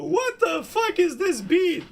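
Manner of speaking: high, slow, drawn-out disbelief; letting the beat blow him away; wide-eyed hype at a hard beat; stunned excitement. Real, not acted. Hyped reaction to a beat.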